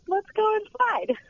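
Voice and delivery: high, slow, playful whisper-like tease; mock sneaky invitation. Tour guide spotting an open door, high and slow mischief. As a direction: lure yourself through the open door. Nosy, peeking through open doors.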